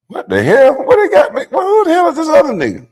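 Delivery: high, loud startled outburst; jumping up in pitch, real surprise; shocked. Real, not acted. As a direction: blurt it out in disbelief. Shocked at a stranger's mugshot.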